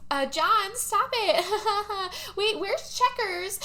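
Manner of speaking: giggly high-pitched flirting, hammed up; Dolly. Dolly to John while making out, high giggly mock protest. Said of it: "Dolly is like".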